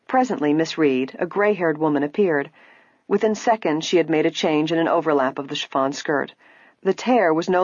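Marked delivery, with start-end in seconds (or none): none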